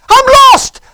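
very high, full-force yell; shouted answer. Retold or imitated. Lost man answering the driver, shouting. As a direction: holler your plight back.

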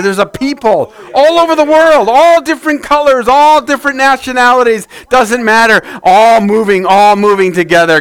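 rising sermon fervor; preaching build-up. Acted. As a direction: lift the congregation with it.

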